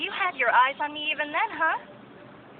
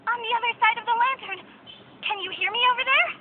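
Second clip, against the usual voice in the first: higher and louder, calling out; 8 semitones higher, 4 dB louder than usual. Acted. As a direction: call across to someone far away. Calling through the lantern.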